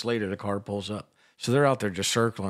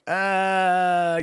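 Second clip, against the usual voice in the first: long, higher, louder "uh" of distrust; reluctant groan; 9 semitones higher, 5 dB louder than usual. Retold or imitated. Retold balk at phone apps.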